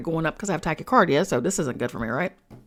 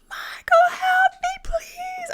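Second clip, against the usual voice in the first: shrieking much higher and louder, begging; terrified scream for help; 5 dB louder, much slower than usual. Retold or imitated. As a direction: scream for the archangel in panic. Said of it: "I'm crying, screaming".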